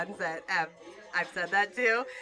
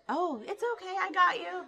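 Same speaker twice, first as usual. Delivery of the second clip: sweet customer; 10 semitones higher than usual. Retold or imitated. Customer voiced in retold exchange.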